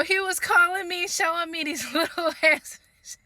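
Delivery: cracking up mid-sentence at the little fish; laughing. Real, not acted. Telling her followers about the fish, laughing. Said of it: "I be laughing at this story myself".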